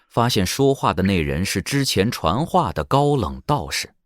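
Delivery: ordinary delivery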